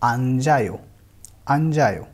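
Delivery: अंजायो said clearly with a j sound; teacher modelling अंजायो; pronunciation demo. Shown as a demonstration. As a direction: carry the j into the next syllable: अंजायो. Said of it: "इसको हम ऐसे पढ़ेंगे".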